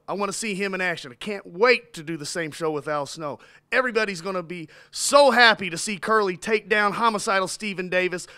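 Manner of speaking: ordinary delivery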